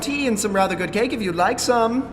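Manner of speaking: loud hail into the house; calling out. Acted. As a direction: shout up to whoever is there. Calling out again.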